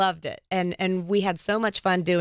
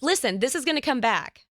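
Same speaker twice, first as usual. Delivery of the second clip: higher pitched, passionate pleading; retold plea to husband, pitched up and insistent; earnest insistence; 6 semitones higher than usual. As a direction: convince him, rising with conviction. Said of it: "And I said".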